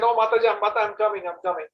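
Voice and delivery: put-on high, eager child calling back; child answering mother. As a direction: call back like a boy busy at play. Child Krishna answering mother's call.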